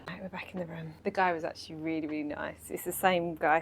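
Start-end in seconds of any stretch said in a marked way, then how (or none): 0.1-0.9 soft whisper. Real, not acted. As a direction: whisper it quietly. Quiet hotel room at night.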